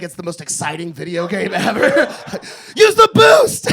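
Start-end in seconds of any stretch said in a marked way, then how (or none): 2.8-3.7 high, loud cheering at the screen; excited gamer shout. Acted. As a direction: yell like it's a video game. Cheering delivery car like game.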